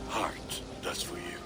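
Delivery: a hushed, loving confession; whispered wedding vow; tender whisper. Acted. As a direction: whisper it softly to her.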